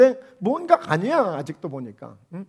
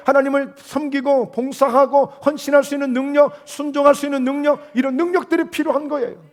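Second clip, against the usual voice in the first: preaching; 5 semitones higher, 3 dB louder than usual. Acted. Preaching list of abilities.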